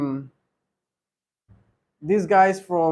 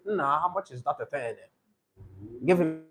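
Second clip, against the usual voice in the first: imitated Anambra accent, lower-voiced; 3 semitones lower, 8 dB quieter than usual. Teacher mimics someone from eastern Nigeria, Anambra accent. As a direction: put on a typical Anambra speaker's accent. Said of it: "typical Opiwe command from Anambra State".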